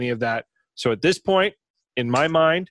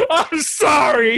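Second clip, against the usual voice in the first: laughing apology; 12 semitones higher, 5 dB louder, slower than usual. Acted. Mock apology to zombie friend.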